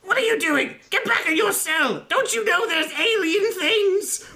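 very high, strained goblin bossing a prisoner; high-pitched indignant goblin officer; goblin commander. Acted. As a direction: order them about in a squeaky goblin voice. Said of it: "immediately the goblin commander notices this".

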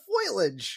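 "foliage" said as FOY-lij; mispronounced foliage. Retold or imitated. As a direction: swap the vowels so "foliage" starts like "foil". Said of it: "It's not foliage, Mom".

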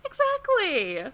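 squeaky, drawn-out high exactly; high emphatic agreement. Pretend. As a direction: stretch it up in a high voice.

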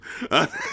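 sudden high embarrassed laugh; high burst of laughter. Real, not acted. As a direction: laugh out, caught off guard. Ashamed of old music.

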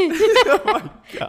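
high, slow, laughing out loud. Real, not acted. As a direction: crack up mid-phrase. Big laugh at joke.

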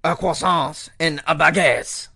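slow, hammed-up French accent. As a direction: savour each word in a French accent. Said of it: "let me do a better French accent than that".

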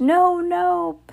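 very high, long-drawn wail; begging. A narrator's reading. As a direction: beg for mercy in a thin high pitch. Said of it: "begged the witch in the front row".

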